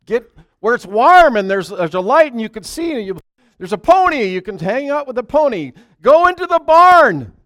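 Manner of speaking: high, loud pleading shout; man shouting at birds to go into the barn; loud coaxing call. Acted. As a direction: holler to coax birds inside.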